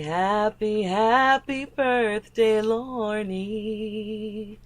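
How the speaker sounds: slow sing-song birthday wish; birthday greeting voice. Pretend. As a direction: stretch it out like a greeting. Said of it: "and say".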